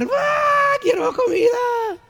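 put-on high, shrieking baby wail, slow on the words; screaming baby. Preacher imitating his seven-month-old daughter screaming for food. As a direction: cry out like a hungry infant whose bottle was taken. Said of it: "y empieza a gritar".

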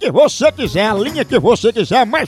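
upbeat radio host reading a live coffee ad; punchy radio announcer pitching coffee. Acted. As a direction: sell it like a lively radio spot.

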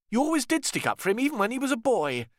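high, quick, bitter snap; snapping. Acted. Richard snaps at Cecily for defending George, high quick bitter. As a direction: snap back at your mother. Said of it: "Richard snapped".

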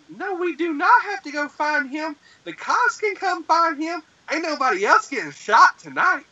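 high-pitched, insistent acted refusal; alarmed protest. Man refusing friends who want to search, high protest.

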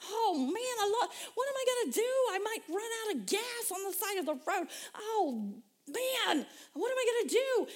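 high, panicky fretting; mock panic. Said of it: "I'm like".

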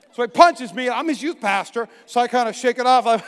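normal talking voice

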